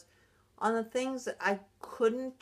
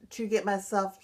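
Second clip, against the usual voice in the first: ordinary talk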